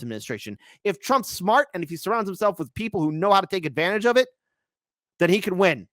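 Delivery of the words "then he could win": ordinary talk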